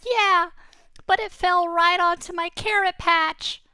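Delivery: very high, loud, squeaky complaint; a small rabbit upset about his carrots. Acted. Rabbit telling the boy about his carrots, squeaky and upset. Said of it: "And he said".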